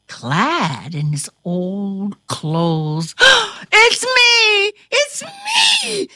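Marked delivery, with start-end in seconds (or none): 3.2-3.7 sharp gasp. A narrator's reading. Caleb transformed back. 3.7-6.1 high, loud joyful cry; exultant shout. A narrator's reading. Caleb, human again, telling Kate: exultant high shout. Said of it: "he shouted exultantly".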